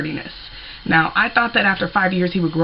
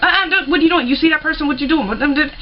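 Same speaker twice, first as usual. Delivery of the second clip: mock backseat alarm; 8 semitones higher, faster than usual. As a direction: nag the driver in a panic. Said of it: "I'm like".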